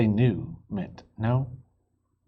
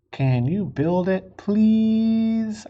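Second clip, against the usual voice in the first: slow, earnest letter reading, pleading at the end; reading the kids' letter; 3 semitones higher, slower than usual. A narrator's reading. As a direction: read it slowly like a child's plea. Reads kids' letter aloud.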